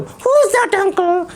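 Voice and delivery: put-on high, slow child puppet voice; puppet boy voice. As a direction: play the cheeky little boy puppet.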